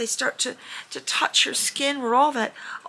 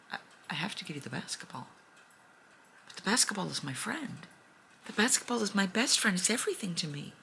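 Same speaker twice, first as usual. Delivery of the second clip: much softer, rushed, hurt voice; sad little girl; 2 semitones lower, 9 dB quieter, faster than usual. Retold or imitated. Girl telling the dragon the ball is her best friend, soft and hurt. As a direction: say it barely above a breath, heartbroken.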